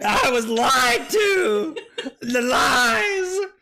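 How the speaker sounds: high, drawn-out wail of betrayal; betrayed wail. Real, not acted. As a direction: cry out as if deeply wronged. Mock betrayal over the fake water.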